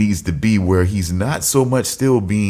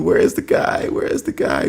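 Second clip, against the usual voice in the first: mock searching; 10 semitones higher than usual. Mock search for missing host.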